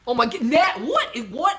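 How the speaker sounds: sudden delighted yelp, jumping high and loud; surprised shout. Real, not acted. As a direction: cry out in happy disbelief. Natalie suddenly shows up in chat.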